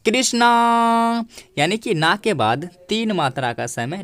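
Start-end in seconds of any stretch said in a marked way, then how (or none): 0.1-1.2 Krishna called out to someone far away, the final ना held long; a drawn-out far-off call of Krishna; calling from afar. Shown as a demonstration. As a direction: call Krishna across a distance, stretching the ना. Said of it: "हम ऐसे बुलाते हैं".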